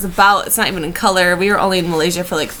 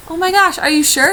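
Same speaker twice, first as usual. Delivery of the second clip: high, eager gush; retold delighted disbelief; 8 semitones higher, 3 dB louder than usual. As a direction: gasp it out, thrilled. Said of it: "And I was like".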